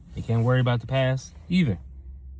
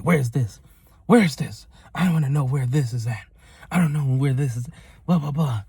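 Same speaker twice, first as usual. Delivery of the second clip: raised pitch, mock-irate demands; imitating angry customer; 4 semitones higher than usual. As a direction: act out a customer's angry tirade. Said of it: "She was so angry".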